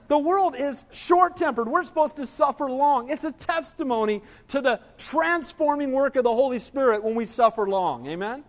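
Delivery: ordinary talk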